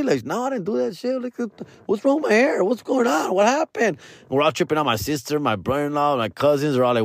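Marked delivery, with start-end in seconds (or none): 0.2-4.0 imitating a baffled niece in a higher voice; high-pitched, bewildered questions; mock alarmed confusion. Niece finding her hair tangled, acted alarmed confusion.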